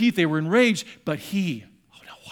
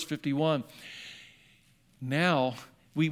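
ordinary delivery

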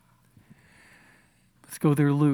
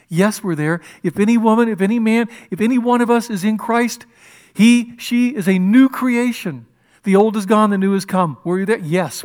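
loud, higher, emphatic preaching; preacher building to a triumphant proclamation; full-force preaching; 5 semitones higher, 8 dB louder than usual. Real, not acted. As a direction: proclaim it with rising power.

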